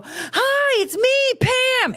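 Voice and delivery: very high, bright sing-song greeting; Pam's voice. Retold or imitated. Imitating Pam greeting Abby, very high sing-song. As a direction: chirp it like a quirky sitcom character. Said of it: "she would go".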